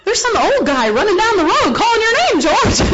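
high, loud laughing exclaim; laughing alarm. Retold or imitated. Telling George an old man is calling him, high and laughing. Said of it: "And I go".

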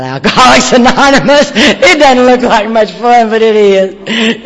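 booming delighted repeat with laughter; laughing. Real, not acted. Repeats grandson's line, laughing.

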